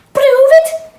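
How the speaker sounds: very high, loud challenge; defiant chickens. Acted. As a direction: dare them sharply. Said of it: "said the chickens".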